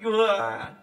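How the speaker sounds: ordinary delivery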